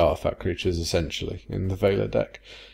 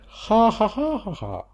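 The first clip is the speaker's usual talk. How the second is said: high, deliberate "ha ha ha"; mock laugh; 11 semitones higher, slower than usual. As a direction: gloat with a fake, spaced-out laugh. Mock laugh after healthcare jibe.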